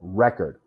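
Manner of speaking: REC-ord with a falling, reduced ending; stress on first syllable. Shown as a demonstration. Teacher repeating the noun Record, stressed on REC. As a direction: say Record as REK-erd, the noun. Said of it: "a noun".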